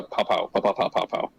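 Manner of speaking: low, rapid mock gunfire; spoken gunshot sound effects; imitated gunshots. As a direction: fire off little gun noises, barely voiced. Imitating gunfire in his country comparison.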